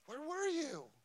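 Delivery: retold yell, pitched high without much volume; restrained high-pitched cry to heaven; yelling at God. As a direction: re-enact crying out to God, voice climbing high. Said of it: "I'm just yelling at God".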